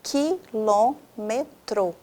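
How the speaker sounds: QUILÔMETRO very slowly split as qui-lô-me-tro; syllable by syllable. Shown as a demonstration. Teacher analysing a word for students, Quilômetro syllable by syllable. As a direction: stretch out each syllable of "Quilômetro". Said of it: "Olha só".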